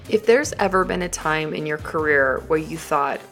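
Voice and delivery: ordinary talk, nothing marked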